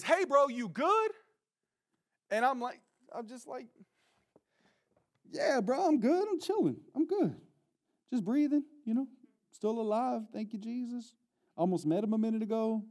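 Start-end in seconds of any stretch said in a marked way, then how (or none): 0.0-1.1 high, drawn-out distant call; far-away voice. Retold or imitated. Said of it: "It's further away than I expected". 5.3-12.8 small, high, strained voice; feigned okay. Retold or imitated. Feigns being fine after near drowning.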